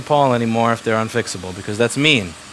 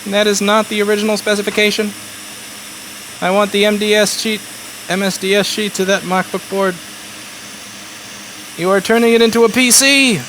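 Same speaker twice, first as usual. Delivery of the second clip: higher, a hint of fussy outrage; mocking pedantic viewer; 5 semitones higher than usual. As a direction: play a fussy nitpicker lightly. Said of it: "Why don't you complain".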